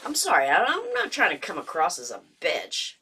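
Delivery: ordinary delivery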